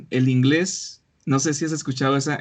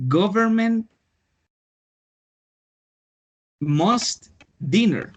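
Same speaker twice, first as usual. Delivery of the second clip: mock student sentence; 6 semitones higher, slower than usual. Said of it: "una oración que dice".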